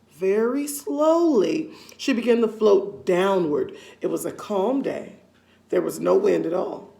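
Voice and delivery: plain reading voice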